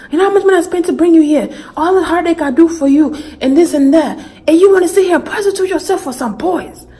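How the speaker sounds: high, fast mock-scolding father; angry dad. Dad guilt-tripping his daughter about money, high mock anger. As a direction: rattle off a dad's outraged guilt trip. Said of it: "he goes".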